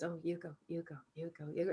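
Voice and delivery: imitating drivers waving each other on; hushed mock courtesy; mock polite deferring. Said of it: "everybody goes".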